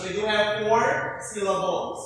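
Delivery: usual speaking voice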